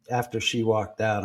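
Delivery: usual speaking voice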